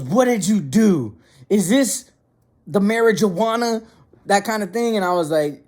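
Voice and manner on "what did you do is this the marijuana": higher, drawn-out mock interrogation; mom's accusing question. Mom grilling her son about the edibles, mock accusing. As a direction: act out a suspicious mother confronting her son. Mother confronts laughing son.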